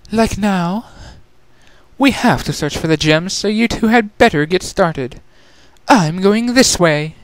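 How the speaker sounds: smug younger sister. Acted. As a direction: tease her sisters from above.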